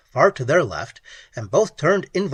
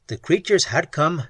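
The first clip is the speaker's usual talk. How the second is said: usual speaking voice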